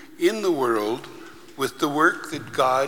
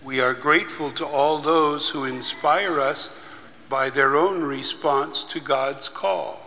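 prayer; 2 semitones higher, 4 dB quieter than usual. Acted. Leads a liturgical prayer.

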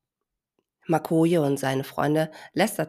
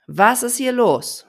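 slow, loud stern demand; 7 semitones higher, 9 dB louder than usual. Acted. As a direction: demand it like a strict mother. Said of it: "nur noch strenger".

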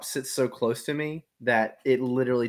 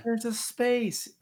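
high, slow, drawn-out correction; high drawn-out there's a space; mock correction; 10 semitones higher, 4 dB quieter, much slower than usual. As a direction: stretch it out in a high teasing voice. Mocking correction about shirt.

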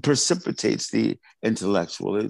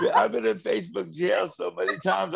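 high-pitched talking through laughter; laughing; 9 semitones higher than usual. Real, not acted. Artist joking with host about Facebook jail, laughing.

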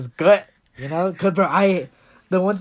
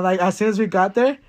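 usual speaking voice

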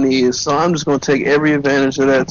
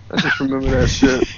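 ordinary talk, nothing marked

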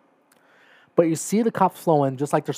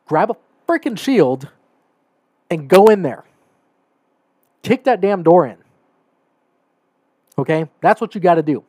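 louder and higher, furious command; angry outburst; 3 semitones higher, 6 dB louder than usual. Real, not acted. An angry cop venting at the officers who stalled, shouting. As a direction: bark the orders like he's fed up. Angry rant at cops' inaction.

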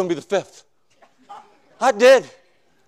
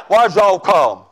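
put-on broader Southern accent with a laugh in it; 3 semitones lower, slower than usual. Preacher repeating the deacon's question, laughing Southern accent. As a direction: lay the Southern accent on thicker while laughing. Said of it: "I don't speak Southern".

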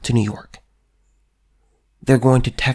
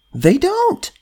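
"don't" jumps far higher and rises at the end; incredulous rise. Real, not acted. Answering his own question about Democrats, with a high incredulous lift. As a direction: toss the repeat up in disbelief. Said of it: "repeated with contrast".

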